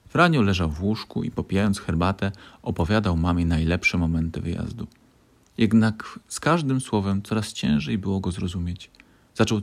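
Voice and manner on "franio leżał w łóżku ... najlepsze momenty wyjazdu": plain reading voice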